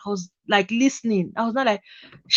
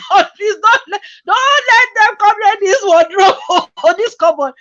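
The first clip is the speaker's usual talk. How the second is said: panicked prayer; 8 semitones higher, 5 dB louder than usual. Retold or imitated. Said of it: "I'm like".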